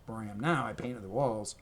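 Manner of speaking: ordinary delivery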